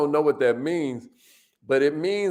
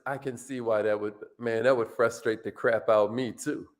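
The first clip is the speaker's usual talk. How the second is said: empathetic; 8 semitones lower, 5 dB quieter than usual. Shown as a demonstration.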